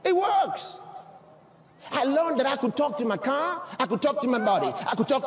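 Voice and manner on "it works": higher-pitched, emphatic preacher's exclamation; excited testimony. Real, not acted.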